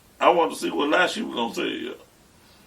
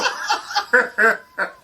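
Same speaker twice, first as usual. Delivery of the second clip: laugh; 11 semitones higher, 3 dB louder than usual. Real, not acted. Husband laughs at joke.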